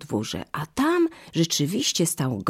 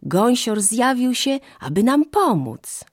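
put-on high, gleeful children's voices; joyful calling; 8 semitones higher, 3 dB louder than usual. A narrator's reading. Children remind mother they told her, calling out joyfully. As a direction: pitch it up high and happily insist. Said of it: "zawołały radośnie dzieci".

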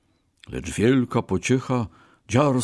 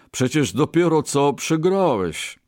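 high, incredulous protest; exclaiming merchant; 7 semitones higher, 3 dB louder than usual. A narrator's reading. Merchant reminds the Brahmin he just lost, exclaiming. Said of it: "wykrzyknął kupiec".

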